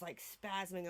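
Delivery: ordinary talk, nothing marked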